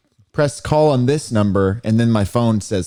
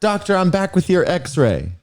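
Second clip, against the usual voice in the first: lightly voiced nurse, higher pitch; nurse role; 8 semitones higher than usual. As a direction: play a nurse reporting in, pitched up a little. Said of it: "yes, I'm nurse".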